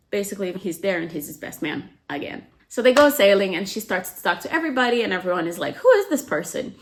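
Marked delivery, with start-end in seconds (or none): 5.8-6.7 high, louder, wide-eyed who is this; imitating the group's amused surprise; mock amazed question. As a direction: pitch it way up in playful wonder. Everyone curious about newcomer.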